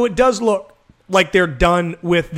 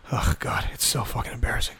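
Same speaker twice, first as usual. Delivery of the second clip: hushed, voiceless cringe; embarrassed mutter; 6 semitones lower, 7 dB quieter than usual. Real, not acted. Son cringing at dad's restaurant act, embarrassed near-whisper. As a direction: let it sink to a low, near-whispered groan. Cringing after impersonating dad.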